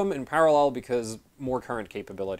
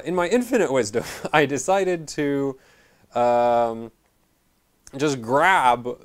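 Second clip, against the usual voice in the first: sarcastic; 2 semitones higher, 4 dB louder, slower than usual. Real, not acted. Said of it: "I'm being sarcastic".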